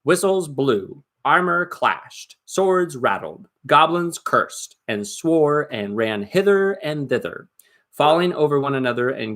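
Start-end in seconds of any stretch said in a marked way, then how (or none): none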